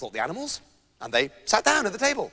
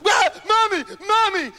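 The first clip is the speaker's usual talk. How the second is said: higher, louder crying for mommy; mock shriek; 10 semitones higher, 5 dB louder than usual. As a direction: wail like a scared child. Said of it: "shrieking at the top of his voice".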